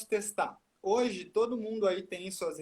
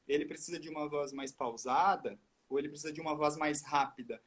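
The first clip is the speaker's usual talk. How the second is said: ordinary talk, nothing marked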